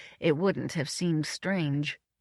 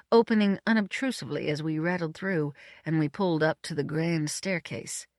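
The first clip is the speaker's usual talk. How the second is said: usual speaking voice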